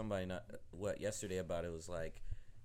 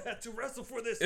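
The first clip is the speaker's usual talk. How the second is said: imitating a friend; 11 semitones higher than usual.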